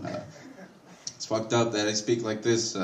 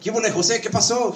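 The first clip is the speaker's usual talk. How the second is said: higher, breezy Spanish hello; casual Spanish greeting; 9 semitones higher than usual. Retold or imitated. Roofer greets new hire Jose, higher casual Spanish. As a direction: greet him like an old friend. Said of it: "It's like".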